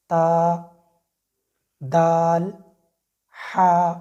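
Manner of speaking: ता, दाल, हा said plainly and one at a time; normal letters. Shown as a demonstration. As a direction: sound out ता, दाल, हा evenly, no extra weight. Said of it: "इनको हमने बिल्कुल normal पढ़ना है".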